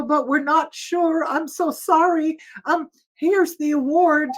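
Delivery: mildly imitating a man almost crying; apologetic near tears. The organiser apologising to the singer, mild mock near-tears. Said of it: "He was practically crying".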